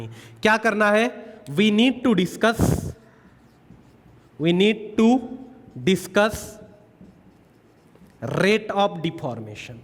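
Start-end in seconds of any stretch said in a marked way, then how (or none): 1.5-6.4 dictating word by word to students; slow, deliberate dictation pace. Shown as a demonstration. Teacher dictates notes slowly. 8.2-9.8 drawn-out key term for notes; very slow dictation. Shown as a demonstration.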